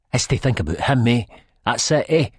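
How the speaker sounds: jealous partner accusing her of another man. A narrator's reading. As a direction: push the accusation up. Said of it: "I sort of raises my voice a bit".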